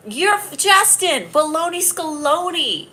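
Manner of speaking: high, slow, silly sing-song; mock a commenter playfully; silly teasing voice. Teasing Justin on her live stream, a high slow silly taunt.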